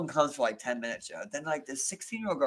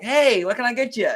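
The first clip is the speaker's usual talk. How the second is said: a loud, high, friendly customer greeting; bright fake-nice cashier; cheery service voice; 7 semitones higher, 8 dB louder than usual. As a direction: sound all sunshine at the counter. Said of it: "I'm like".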